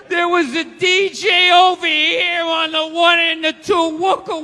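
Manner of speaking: oversell the cruise like a giddy tourist; a gushing tourist bragging about the buffet; hammed-up cruise rave. Excited cruise-goer rave, hammed up.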